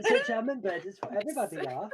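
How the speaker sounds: put-on jam and bread in a German accent. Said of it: "start speaking in a German accent".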